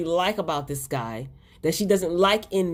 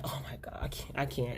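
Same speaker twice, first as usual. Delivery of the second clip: breathy near-whisper, barely voiced; hushed overwhelmed reaction; 4 semitones lower, 12 dB quieter than usual. Viewer reacting to the dying father's words, whispered. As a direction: whisper it like you can't take any more. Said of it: "And I'm just like".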